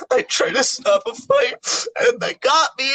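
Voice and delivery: high wailing sob-voice, slow and broken; hammed-up mock crying; imitating crying. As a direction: whimper it like a beaten kid telling the teacher. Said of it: "I was crying".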